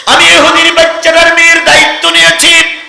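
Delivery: high, loud preaching cry; full-force sermon chant; impassioned declaration. Acted.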